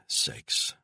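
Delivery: ordinary delivery, nothing marked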